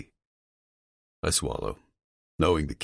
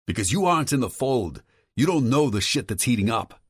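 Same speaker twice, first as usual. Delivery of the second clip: higher, agitated male voice on the phone; a worried friend pushing hard, voice raised in pitch; Tom's voice; 8 semitones higher, 4 dB louder than usual. Acted. Tom warning Vincent about his father, higher and agitated.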